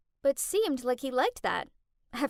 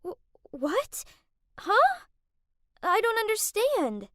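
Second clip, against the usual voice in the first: stunned, stammering confusion, pitched up; spluttering; 9 semitones higher, 4 dB louder than usual. Acted. Kath, blindsided on the phone, spluttering in shock. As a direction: caught off guard, fumbling for words. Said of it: "Kath spluttered out".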